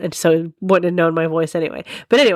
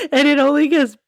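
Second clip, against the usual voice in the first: talking through laughter; real laughing; 6 semitones higher than usual. Real, not acted. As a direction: try to get the words out while cracking up. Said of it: "I laughed too hard telling that story".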